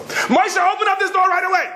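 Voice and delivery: high, frantic shouting through the door; yelling at husband. Retold or imitated. Locked-out wife yells at husband through door, high shout. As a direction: pound on the door with your voice.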